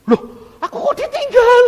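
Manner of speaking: high, loud cry of protest; abandoned shout. Retold or imitated. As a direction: shout after someone who left you behind. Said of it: "Di situ saya teriak". (teriakan protes karena ditinggal)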